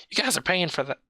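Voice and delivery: high whiny complaint; whining. Acted. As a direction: grumble it in a high whine. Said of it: "whines the driver".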